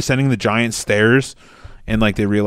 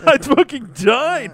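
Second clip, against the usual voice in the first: high, slow squealing delivery; hilarity; 14 semitones higher, slower than usual. Real, not acted. Telling a friend about the uncut episode, high squealing hilarity. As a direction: squeal it out in delighted disbelief. Recounting laughing hard at the episode.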